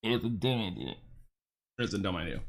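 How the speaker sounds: low, soft grumble to himself; low mutter. Real, not acted. Streamer scolding himself, low mutter. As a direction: mumble it under your breath.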